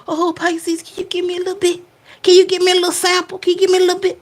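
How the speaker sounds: high, wheedling mooch voice; mock begging ex. Ex begging Pisces for a sip, high mock plea. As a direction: beg sweetly for a sip. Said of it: "they go grab their little cup".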